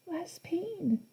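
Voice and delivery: fading to a breath. A narrator's reading. Echo fades.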